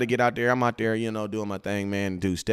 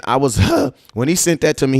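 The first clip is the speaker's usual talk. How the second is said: ordinary talk, nothing marked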